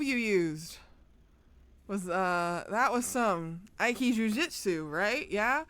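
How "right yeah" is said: ordinary talk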